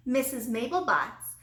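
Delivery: ordinary delivery, nothing marked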